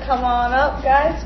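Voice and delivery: ordinary talk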